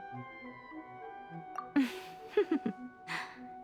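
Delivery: soft chuckle. Acted. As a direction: chuckle softly to yourself. Fox lady chuckles after giving gift.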